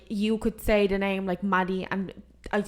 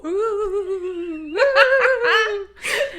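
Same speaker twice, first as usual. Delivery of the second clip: high cackling witch laugh; witch cackle; 12 semitones higher, 7 dB louder than usual. Acted. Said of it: "I sound like a witch there".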